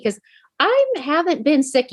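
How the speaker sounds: ordinary talk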